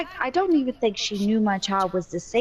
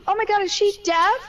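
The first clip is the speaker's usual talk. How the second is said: much higher, mock-shocked stranger; hammed-up gawking stranger; 11 semitones higher than usual. Mom mocks a stranger gawking at the hearing aid, high and hammed-up. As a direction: overplay the nosy stranger's gasp. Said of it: "Or they'd go".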